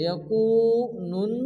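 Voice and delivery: "ইয়াকুনুন" slowly, the ওয়াও held long; slow, careful "ইয়াকুনুন"; madd demo. Shown as a demonstration. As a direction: stretch the u of "ইয়াকুনুন". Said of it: "এক আলিফ পরিমাণ টান দিয়ে পড়তে হবে".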